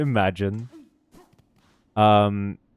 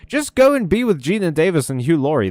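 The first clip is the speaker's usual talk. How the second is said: higher, exasperated outburst; 8 semitones higher than usual. Real, not acted. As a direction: fire back in exasperation, pitch jumping up. Exasperated reply to the comment.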